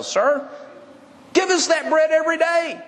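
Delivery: high, pleading mock voice; mock greedy crowd. Crowd wanting bread every day, high mocking plea. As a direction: beg like someone chasing a free meal.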